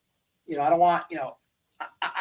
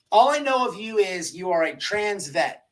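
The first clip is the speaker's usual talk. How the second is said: usual speaking voice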